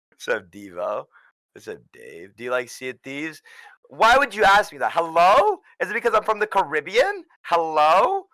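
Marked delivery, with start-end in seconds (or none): 3.9-8.2 indignant, higher and louder protest; mock offended. Guest acting offended at chat about the Caribbean, indignant and loud. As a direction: act insulted by a chat question.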